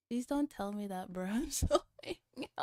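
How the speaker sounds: ordinary delivery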